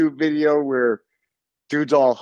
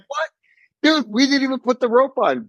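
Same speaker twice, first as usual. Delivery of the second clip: much higher-pitched imitated astonishment; mock disbelief; 9 semitones higher than usual. Imitating bungee crew to a jumper, mock disbelief. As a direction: act out the crew's stunned protest.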